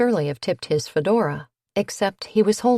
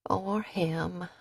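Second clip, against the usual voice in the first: much softer finishing line; quiet aside; 8 dB quieter than usual. A narrator's reading. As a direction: drop the voice, quietly.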